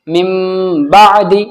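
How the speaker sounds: "मिम" with a held hummed m before "बादी"; flowing recitation. Shown as a demonstration. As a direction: linger on the m of "मिम". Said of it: "रवानगी से पढ़ते हैं". ("मिम" पर गुन्ना, फिर "बादी")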